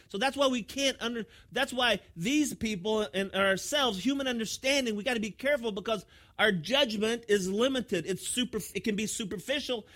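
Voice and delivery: loud, high-pitched sermon delivery; full-force preaching. Real, not acted. Preacher warns congregation about judging people, preaching forcefully. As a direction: drive the point home to the congregation, loudly.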